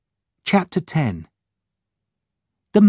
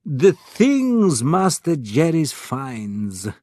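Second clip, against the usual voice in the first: put-on slow, higher, foreign-accented exclamation; Spiro's exclamation, foreign accent; 4 semitones higher, slower than usual. A narrator's reading. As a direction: marvel in broken, foreign-accented English. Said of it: "cried Spiro".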